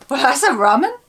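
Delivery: amused Suffolk remark; light, amused local saying; Suffolk accent. Shown as a demonstration. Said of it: "And you say".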